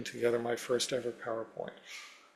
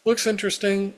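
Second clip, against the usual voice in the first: upbeat; 8 semitones higher, 8 dB louder than usual. Retold or imitated. Quoted email, upbeat reading.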